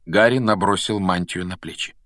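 usual speaking voice

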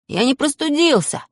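put-on boy's high shouted protest. A narrator's reading. Boy protests to his parents, high shout. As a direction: yell it, pitch jumping high. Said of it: "закричал я".

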